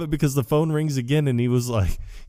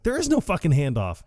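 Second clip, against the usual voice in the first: higher-pitched mock exasperation; exasperated retort; 6 semitones higher than usual. Dude telling Walter about the plan, high exasperated retort. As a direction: imitate an exasperated correction.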